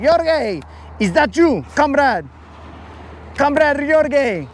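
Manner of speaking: high mock yell with a Russian twist; mock Russian shout. A streamer imitating the trucker calling him comrade, high mock Russian yell. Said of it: "he's like".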